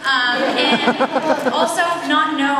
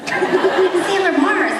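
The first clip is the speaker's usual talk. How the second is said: slow, higher, louder mock superhero claim; dramatic declaration; 6 semitones higher, 5 dB louder, much slower than usual. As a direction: proclaim it grandly with a laugh. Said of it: "he backed away slowly".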